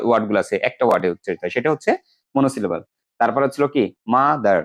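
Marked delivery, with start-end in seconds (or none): 4.1-4.6 মাদার in two beats, মা, দার; মাদার with a break after মা; split into syllables. Shown as a demonstration. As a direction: slowly separate মা from দার in মাদার. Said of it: "দুইটা syllable আছে".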